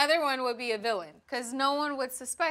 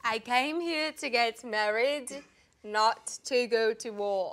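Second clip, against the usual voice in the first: slow, measured South African accent; slower than usual. Shown as a demonstration. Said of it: "we really need to hear you doing a South African".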